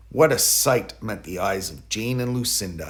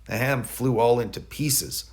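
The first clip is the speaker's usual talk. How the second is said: ordinary delivery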